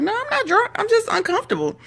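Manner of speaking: squeaky put-on protest; high-pitched mock protest. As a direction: imitate a defensive denial in a very high voice. Voices Charmaine denying being drunk.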